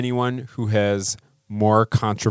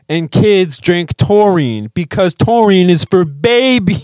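frat bro; 6 semitones higher, 5 dB louder than usual. Pretend. Loud bro boasting.

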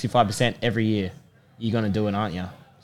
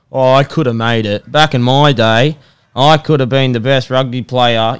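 loud, drawn-out pub boasting; boastful pub guy; 2 semitones higher, 9 dB louder, slower than usual. Acted. Guy at the pub boasting to his mates, loud and slow. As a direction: loudly mimic a pub has-been, stretching the words. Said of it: "that guy at the pub".

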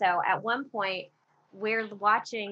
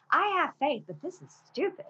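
higher-pitched mock outburst at the pastor; retold yell; 7 semitones higher than usual. Woman snapping back at a comforting pastor, retold yell. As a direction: replay an exasperated yell, pitched up. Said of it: "I yelled at him".

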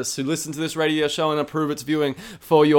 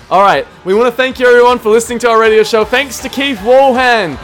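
radio host outro; 6 semitones higher, 12 dB louder than usual. Acted. Radio show sign-off.

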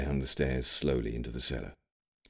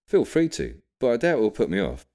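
much higher, louder and quick; young William; 8 semitones higher, 6 dB louder than usual. A narrator's reading. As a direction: voice the grandson, brushing off the warning. William's voice in story.

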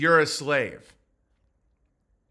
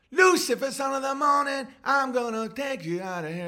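mock sermon; 7 semitones higher than usual. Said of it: "And then it's like".

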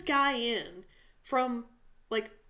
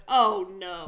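loud groaned oh no; mock dismay; 3 semitones lower, 8 dB louder than usual. Moviegoer groans loudly in dark theater. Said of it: "I went".

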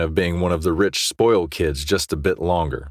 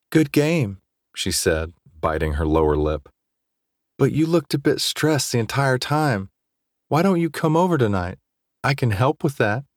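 put-on higher, breathy-free teasing girl; 7 semitones higher than usual. A narrator's reading. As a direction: coax him sweetly, higher pitched. Nicole voiced in story.

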